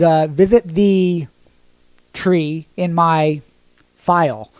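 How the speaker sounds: slow, drawn-out, deliberately vague; coy slow dodge. Real, not acted. Teacher hints at the link without saying it, slow and coy. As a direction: stretch the words to dodge the algorithm. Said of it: "can't say it 'cause the algorithm will spank me".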